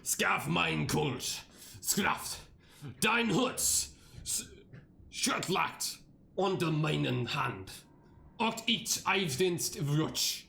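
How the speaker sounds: slow, dragged-out attempt at German words; playful stab at reading German aloud; mock German reading. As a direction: sound out the German slowly, like a first try. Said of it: "Time to do the German".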